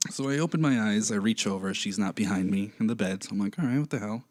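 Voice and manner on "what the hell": ordinary talk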